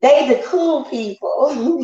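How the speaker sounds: high, slow put-on voice; teasing, exaggerated high answer; mocking imitation. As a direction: mimic them in a silly drawn-out high voice. Acted answer to own question.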